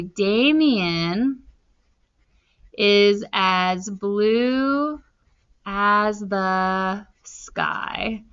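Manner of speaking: slow, spaced dictation. Shown as a demonstration. Teacher writing a simile example, slow dictation. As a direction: drag each word while writing. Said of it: "I'm gonna say".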